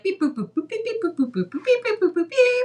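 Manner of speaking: high, loud beep boop noises; mouthed keypad beeps. Shown as a demonstration. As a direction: chirp out robot beeps. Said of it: "we need a sound effect of".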